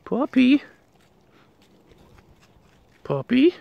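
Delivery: high little puppy call; cutesy pet call. Real, not acted. Vlogger calling a puppy, high cutesy voice. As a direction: call the pup in a tiny high voice.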